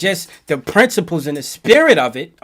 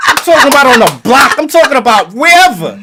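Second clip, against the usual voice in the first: loud, high-pitched, insistent correction; worked-up shout of clarification; emphatic insistence; 8 semitones higher, 9 dB louder than usual. Real, not acted. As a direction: raise your voice and hammer the point home. Heated insistence over what cyphers mean.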